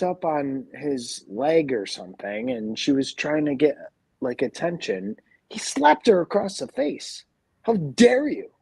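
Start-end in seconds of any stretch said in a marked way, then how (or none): 5.5-8.5 outraged. Real, not acted. Outraged about dog slapped.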